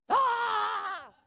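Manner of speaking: sudden high loud scream; hammed-up preacher yell "Ah!"; imitated preacher's scream. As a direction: let out the pastor's big preaching scream. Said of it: "Kick his foot and scream".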